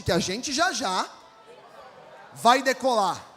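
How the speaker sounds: slow, building to a shout; rally the church to shout it; congregation shout. Shown as a demonstration.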